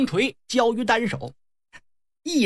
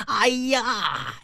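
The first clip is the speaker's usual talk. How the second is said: high, drawn-out cry of shock; aghast exclamation; 4 semitones higher than usual. Acted. Youngest brother sees three brothers dead, high aghast cry. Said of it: "眼珠子都快冒出来了".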